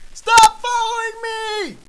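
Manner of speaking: very high, drawn-out scream; angry yell. Acted. Yumbly yells at Schnorks who follows, screaming. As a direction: shriek it loudly at a pursuer.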